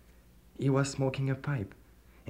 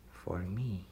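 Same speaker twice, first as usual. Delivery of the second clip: soft, sinister whisper; 3 semitones lower, 10 dB quieter than usual. A narrator's reading. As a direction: whisper the price like a threat. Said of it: "he said".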